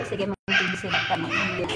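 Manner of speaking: low, soft, laughing words; real quiet laugh as the prank lands; laughing under breath. Real, not acted. Laughing, voice drops away.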